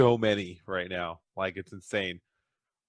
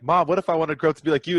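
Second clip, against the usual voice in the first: put-on eager little girl; 5 semitones higher, 4 dB louder, faster than usual. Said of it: "She's like".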